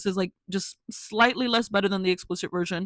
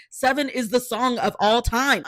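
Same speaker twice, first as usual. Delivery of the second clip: excited insistence; 5 semitones higher, 5 dB louder than usual. Real, not acted. Repeats claim, louder higher.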